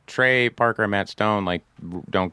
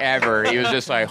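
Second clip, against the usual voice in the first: ordinary delivery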